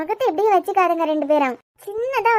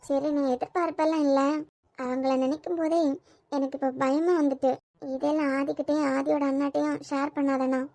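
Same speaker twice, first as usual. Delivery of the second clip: softer and lower, anxious confession; quiet fear; 4 semitones lower, 7 dB quieter than usual. Acted. Kani admitting fear of the in-laws, softly anxious. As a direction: speak low as if afraid of being overheard.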